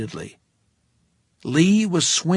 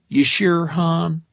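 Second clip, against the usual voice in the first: high, thick Texas waitress drawl; friendly Texas accent, laid on thick; 5 semitones higher, 6 dB louder, slower than usual. A narrator's reading. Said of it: "in a Texas accent".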